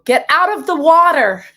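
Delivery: loud, high lifeguard order with careful "water"; over-correct shouted command; practiced standard-English shout. Acted. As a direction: yell it in freshly learned proper diction. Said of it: "I'm practicing".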